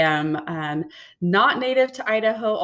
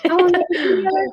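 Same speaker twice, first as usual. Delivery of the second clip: high, fast, puzzled inner voice; quickly asking herself, surprised; puzzled self-quote; 12 semitones higher, 6 dB louder, much faster than usual. Retold or imitated. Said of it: "I was like".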